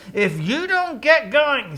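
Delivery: high, slow exasperated warning; drawn-out, hammed-up ultimatum; mock threat. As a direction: act out losing patience with an animal. Balaam threatens the stubborn donkey.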